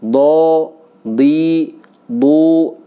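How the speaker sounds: teacher repeating drill; letter drill. Shown as a demonstration. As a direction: chant each dhad syllable.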